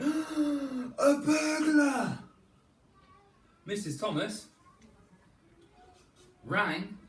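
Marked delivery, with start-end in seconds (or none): 0.0-2.2 high, loud, slow gasping cry; alarmed cry. Acted. Father spots a burglar, a high loud alarmed cry. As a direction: raise the alarm.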